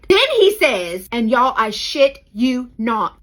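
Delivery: ordinary talk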